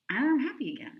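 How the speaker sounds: loud, high burst of joy; happy burst. Real, not acted. Bursting with joy after whispers.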